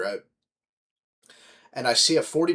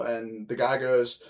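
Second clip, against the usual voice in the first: usual speaking voice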